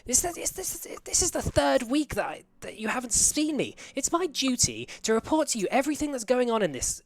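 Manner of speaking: high, loud, demanding female voice; high-pitched insistent official banging to get in; angry woman's voice. Acted. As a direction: shout through a locked door as an outraged woman. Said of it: "like, a female's voice going".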